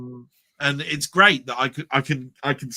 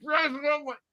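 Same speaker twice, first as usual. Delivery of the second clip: much higher, disbelieving complaint; indignant moviegoer; 9 semitones higher than usual. Retold or imitated. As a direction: play a stunned punter who missed the ending. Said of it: "people were just turning around and going".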